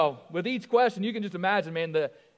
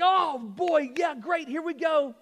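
eager owner; 7 semitones higher, 4 dB louder, slower than usual. Acted. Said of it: "the guy was like".

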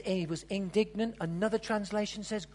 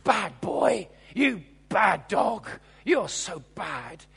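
mock scolding; 4 semitones lower, 7 dB louder, slower than usual. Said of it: "we all jumped to conclusions".

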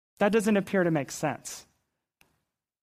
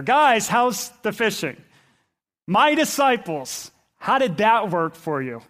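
drawn-out, teasing sarcasm; somewhat sarcastic; 3 semitones higher, 4 dB louder than usual. Acted. Jesus to his empty-netted disciples, somewhat sarcastic. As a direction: rib them with slow, pointed questions. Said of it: "It's almost somewhat sarcastic here".

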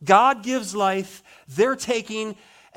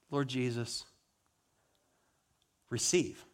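quiet prayer; 6 semitones lower, 10 dB quieter than usual. Acted. Stephen's dying prayer again.

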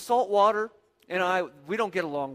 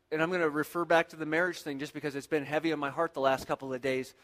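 ordinary delivery

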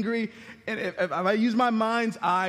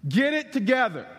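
louder, higher barked command; rough dad order; 6 semitones higher, 5 dB louder than usual. Pretend. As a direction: snap at the boys to shape up. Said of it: "rougher with them".

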